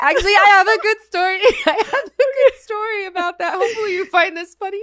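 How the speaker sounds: can barely get it out for giggling; bubbling laughter before a funny story; laughing while talking. Real, not acted.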